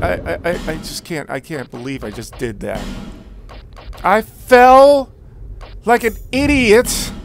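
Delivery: usual speaking voice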